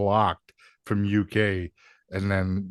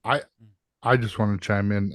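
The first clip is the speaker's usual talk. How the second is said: ordinary talk, nothing marked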